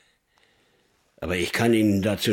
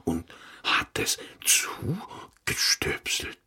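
whispering; 3 dB quieter, slower than usual. Acted. Hushed report of strange evidence.

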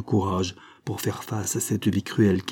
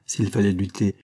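ordinary delivery, nothing marked

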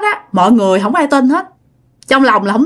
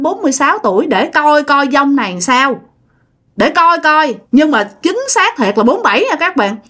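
normal talking voice

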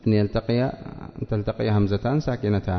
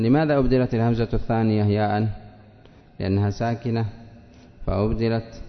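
ordinary talk

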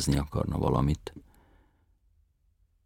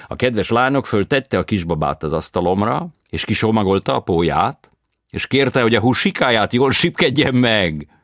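foreign tenor voice; 8 semitones higher, 11 dB louder than usual. A narrator's reading. Tenor recounts.

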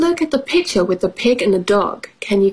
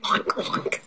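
soft, voiceless pig grunts; small snuffly storybook oinks; 10 dB quieter than usual. Acted. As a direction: imitate a pig under the breath. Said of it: "said pig".

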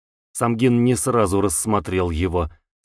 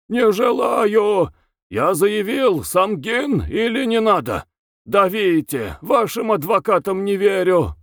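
shouting prisoner; 10 semitones higher than usual. A narrator's reading. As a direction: scream your refusal at him. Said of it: "закричал". (крик: «не желаю!»)

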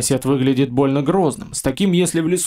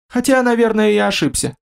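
nervously pitched up; timid merchant; 7 semitones higher than usual. A narrator's reading.

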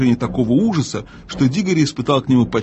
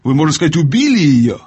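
louder, higher accusation; appalled, raised voice; crying out; 4 semitones higher, 4 dB louder than usual. A narrator's reading. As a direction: cry it out in shocked accusation, rising on the last words. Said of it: "закричал Дигори".

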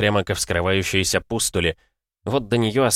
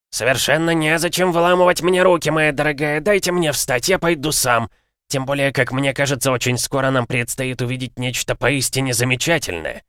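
put-on high, ironic old stranger; a condescending gentleman, unhurried; haughty stranger voice; 6 semitones higher than usual. A narrator's reading. (надменный голос незнакомца)

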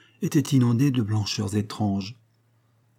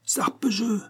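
grumbled oath in a much higher voice; an old grouch's high-pitched curse; grumbling; 12 semitones higher, slower than usual. A narrator's reading. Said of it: "bougonnait Toinose". (juron bougonné, voix haut perchée)

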